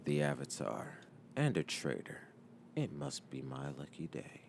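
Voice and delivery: very low, soft, sinister voice; hushed, menacing musing; villainous commander. A narrator's reading. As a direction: gloat quietly to yourself. Commander Zhao gloats on seeing Avatar.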